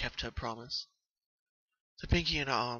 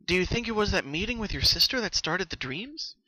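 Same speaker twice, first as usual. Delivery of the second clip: higher, louder curious question; 8 semitones higher, 6 dB louder than usual. Acted. Twilight asking Pinky about her sister, curious. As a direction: wonder aloud, probing. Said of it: "Twilight asked curiously".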